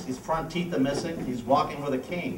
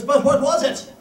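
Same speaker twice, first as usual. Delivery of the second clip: Irish bartender; 9 semitones higher, 7 dB louder than usual. Acted. Bartender pressing, pitch jumps.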